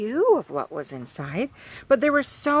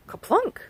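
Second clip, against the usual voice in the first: sound effect word; 13 semitones higher than usual. Acted.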